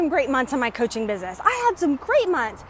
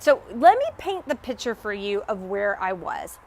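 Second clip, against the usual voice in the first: ordinary delivery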